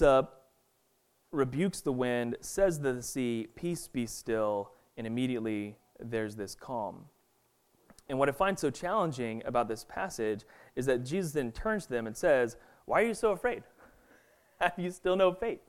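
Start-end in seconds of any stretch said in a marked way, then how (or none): 3.5-4.7 calm command. Retold or imitated. Jesus rebukes the wind and sea. 12.8-15.6 gentle rebuke. Retold or imitated. Jesus questions the frightened disciples.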